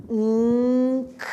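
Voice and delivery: nk held and stretched out; isolated sound demo. Shown as a demonstration. Teacher answering her class: held nk. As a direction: model "Nk." as one drawn-out nasal stop. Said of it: "What did you hear?".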